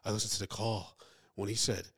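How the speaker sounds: usual speaking voice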